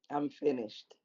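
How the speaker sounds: normal talking voice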